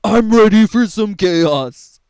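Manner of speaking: loud mocking quote of a hyped-up fan; mock hype fan. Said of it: "what the idiots are saying".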